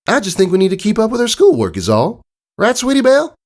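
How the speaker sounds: high fast filly voice; Applebloom, covering. Acted. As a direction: rush the excuse out high and nervous.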